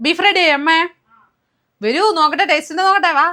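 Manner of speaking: loud, high-pitched call across the house; calling out to mother. Real, not acted. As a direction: holler for mum, eager to check on the food. Calls out to mother on arriving home.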